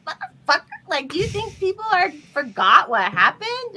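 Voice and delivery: high, loud, incredulous outburst; indignant retort. Retold or imitated. Talking back to the governor, indignant and high. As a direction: let the outrage climb in pitch.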